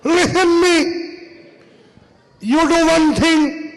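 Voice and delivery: high, slow Pakistani clerk taking charge; Pakistani accent. Acted. Pakistani clerk takes charge of the room, Pakistani accent. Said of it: "That means".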